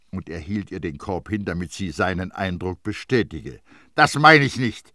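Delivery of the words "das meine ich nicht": loud, brusque retort; curt and irritated; gruff. A narrator's reading. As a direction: brush him off impatiently, voice raised. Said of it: "sagte die Amme unwirsch".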